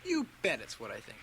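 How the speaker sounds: menacing threat. Acted. Bully threatens Dunny over snowball.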